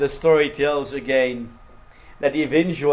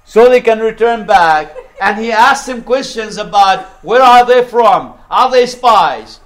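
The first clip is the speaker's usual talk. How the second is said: higher, louder pulpit storytelling; full-force preaching; 6 semitones higher, 7 dB louder than usual. Real, not acted. Preacher tells congregation of Joseph testing his brothers, full-force preaching. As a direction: drive the story out loud and high.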